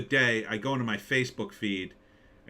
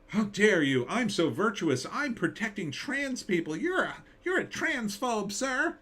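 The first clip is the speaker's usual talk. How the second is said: rising higher and higher into a prissy mock outrage; mock indignant; 10 semitones higher than usual. Mimicking an online virtue signaller, pompous mock outrage. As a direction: parody of self-righteous indignation.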